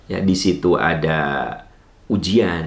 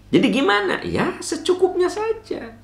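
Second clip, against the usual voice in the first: playful high answer. Acted. Preacher answers own question playfully.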